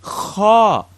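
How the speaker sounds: "Kha" scraped from the back of the throat; teacher demonstrating guttural "Kha"; throaty kha. Shown as a demonstration. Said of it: "Makes the sound kha".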